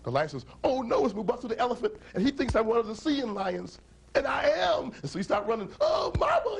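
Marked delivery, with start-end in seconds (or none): 0.6-4.9 high-pitched alarmed lion; mock panic. Lion spots hostile elephant. 5.8-6.6 very high mock-terrified shout; comic panicked cry. Lion flees the elephant.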